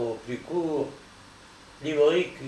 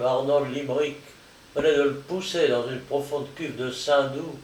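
ordinary delivery

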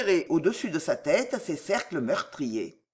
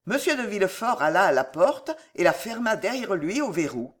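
ordinary delivery

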